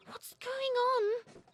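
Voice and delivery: frightened bird. A narrator's reading. Robin trapped in a box.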